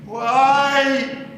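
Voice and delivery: rising outburst. Acted. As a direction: break and let it all out. Breaking down, rising cry.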